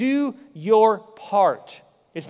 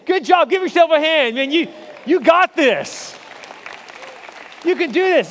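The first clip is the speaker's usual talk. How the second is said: excited cheering; 7 semitones higher, 4 dB louder than usual. Real, not acted. Cheering congregation on.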